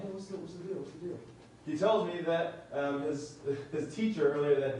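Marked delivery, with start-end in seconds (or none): 0.0-1.2 quietly pulling someone aside; hushed aside. Retold or imitated. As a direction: ask it softly, just between you and the student. Pulling student aside to talk privately.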